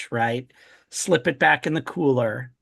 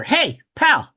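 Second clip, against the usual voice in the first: higher, louder hail; raised-voice greeting to interrupt someone; calling out; 9 semitones higher, 5 dB louder than usual. Acted. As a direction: get someone's attention sharply. Acted hail after tapping shoulder.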